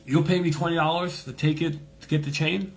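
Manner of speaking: high-pitched imitation of a Jamaican, questioning; Jamaican man. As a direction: check the offer in a put-on Jamaican voice. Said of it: "Jamaican guy".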